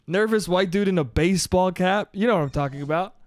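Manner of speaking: ordinary talk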